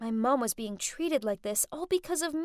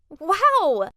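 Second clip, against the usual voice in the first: amazed wow; 4 semitones higher, 9 dB louder than usual. A narrator's reading. Amazed at rich friend's club.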